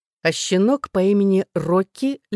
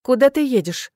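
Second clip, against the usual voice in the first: higher, startled question; alarmed; 6 semitones higher, 4 dB louder than usual. A narrator's reading. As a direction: react with sudden worry. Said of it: "всполошилась я". (всполошившись, встревоженно)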